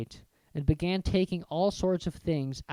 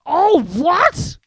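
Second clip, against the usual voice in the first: much louder, much higher roar; All what? thundered in outrage; 14 semitones higher, 13 dB louder than usual. Acted. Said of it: "Hagrid thundered".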